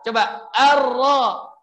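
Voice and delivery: arro with heavy tafkhim R; full-mouthed arro, slow; thick Arabic ro. Shown as a demonstration. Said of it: "Enggak boleh dibaca arra".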